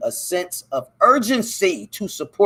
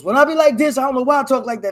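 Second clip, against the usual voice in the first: pitched way up and pushed louder; high strained voice; 9 semitones higher, 6 dB louder, faster than usual. Shown as a demonstration. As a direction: squeeze the voice high until it strains. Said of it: "That shit hurt my throat".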